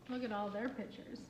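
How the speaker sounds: ordinary delivery, nothing marked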